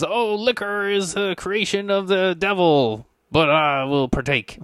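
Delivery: high, slow lamenting sermon; preaching priest. Acted. Mad priest accepts drinking money, high slow sanctimonious preaching. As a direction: lament it grandly from the pulpit, then relent. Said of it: "to which point he says".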